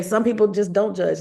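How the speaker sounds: usual speaking voice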